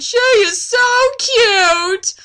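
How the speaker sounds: high, slow, loud gush; Strider voice. Acted. Strider cooing over Laura, high and slow.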